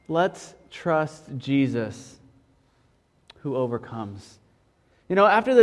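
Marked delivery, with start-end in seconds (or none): 0.1-4.4 slow, deliberate, fading off at the end; slow emphatic restatement. Shown as a demonstration. Preacher stating the sermon's main idea, slow and deliberate. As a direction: land the main idea slowly and softly. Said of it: "that's our main idea today".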